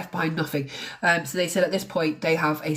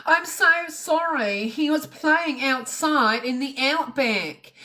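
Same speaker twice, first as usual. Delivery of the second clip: slow, much higher mock Australian drawl; mocking Australian accent; 7 semitones higher, much slower than usual. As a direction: ham up the Australian newsreader, slowly. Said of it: "Why is she talking like that?".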